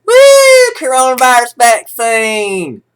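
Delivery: mock cheer. Sarcastic cheer at vaccine news.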